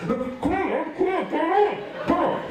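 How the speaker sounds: high, pestering big brother; hammed-up impatient egging on; impatient urging. Brother urges him, hose in mouth.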